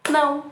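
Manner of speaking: Não said as one firm beat; single beat demo. Shown as a demonstration. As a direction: say it like one clap. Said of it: "quantos impulsos de voz". (Não dito num só impulso)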